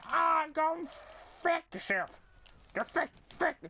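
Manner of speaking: high-pitched mock team rage; hammed-up angry squawk; mock outburst. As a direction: imitate an exasperated teammate cussing someone out. Mimics team's angry cussing.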